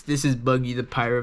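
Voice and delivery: ordinary talk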